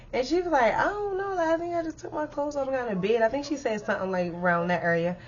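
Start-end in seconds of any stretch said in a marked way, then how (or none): 0.7-3.2 high, soft, spacey reply; lightly voiced dazed friend. Susie explaining she just got in bed, soft dazed voice. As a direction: play her clueless and quiet.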